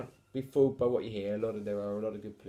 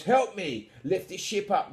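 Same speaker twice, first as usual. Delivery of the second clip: higher, strained little crook; wheezing burglar; 7 semitones higher, 6 dB louder than usual. A narrator's reading. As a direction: wheeze it like a struggling thief. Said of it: "wheezed the shorter one".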